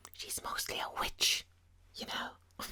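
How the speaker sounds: hushed whisper, like sharing a secret; conspiratorial whisper. Real, not acted. Telling viewers the lonely woman's a witch, whispered. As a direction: whisper it as gossip.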